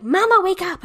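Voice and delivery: put-on high, loud child's call; toddler voice. Mom quoting her son waking her, high and loud. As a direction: pipe it up like a small kid. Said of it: "this kid will be like".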